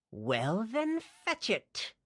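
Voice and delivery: high, weary villain sneer; exasperated villain. Acted. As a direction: talk down to a fool. Towin sighs at slow-witted man.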